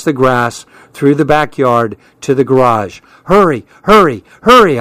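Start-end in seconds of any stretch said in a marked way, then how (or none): 3.2-4.8 raised, louder urgent calls; urgent cry. A narrator's reading. Child hustling a frightened companion to the garage, urgent cry. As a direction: cry out each word, rising in urgency. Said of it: "I cried out".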